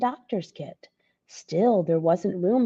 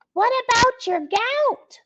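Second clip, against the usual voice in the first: high, louder anxious gnome cry; worried gnomes; 5 dB louder than usual. Acted. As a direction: gnomes fretting over Santa. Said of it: "the gnomes cried out".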